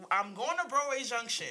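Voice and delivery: ordinary talk, nothing marked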